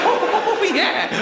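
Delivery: lightly hammed-up, high jolly whoop; jolly fat person. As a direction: play a jolly, carefree character. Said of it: "They're jolly".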